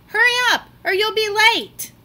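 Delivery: high, loud hurry-up yell; imitated shout. Child mimicking parent shouting about being late. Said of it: "No one shouting".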